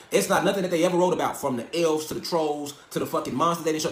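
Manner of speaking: normal talking voice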